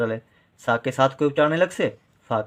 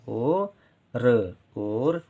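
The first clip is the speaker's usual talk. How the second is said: slow sounding out; much slower than usual. Shown as a demonstration. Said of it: "teacher sounds out the word".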